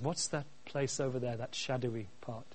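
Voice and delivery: a hint of a cub asking quietly; lightly voiced Simba. Preacher retelling Simba asking his father, lightly voiced and hushed. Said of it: "And Simba says".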